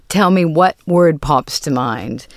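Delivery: usual speaking voice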